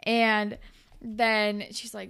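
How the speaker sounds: ordinary talk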